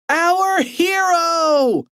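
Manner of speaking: put-on slow high cheering kid voice; shouted. A narrator's reading. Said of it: "the boys shouted".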